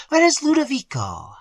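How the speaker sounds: high, pressing question; anxious mistress. A narrator's reading. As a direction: ask urgently after Ludovico. Mistress asks after servant.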